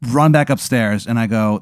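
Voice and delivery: normal talking voice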